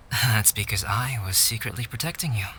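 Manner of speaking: usual speaking voice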